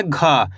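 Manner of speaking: घा voiced with a puff, dropping low; letter-sound demo. Shown as a demonstration. Teacher shows how this letter is read, letter-sound demo. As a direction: draw out an aspirated, falling घा. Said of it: "इसको कैसे पढ़ेंगे?".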